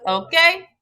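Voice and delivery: ordinary talk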